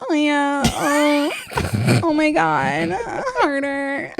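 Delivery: high mock moaning; hammed-up high Sasquatch moans; joke Sasquatch sounds. Joking about the Bigfoot show, mock Sasquatch moaning. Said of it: "he was making Sasquatch sounds like".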